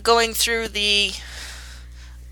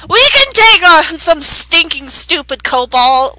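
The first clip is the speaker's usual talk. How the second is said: put-on boastful kid; 5 semitones higher, 8 dB louder than usual. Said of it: "Matan's like".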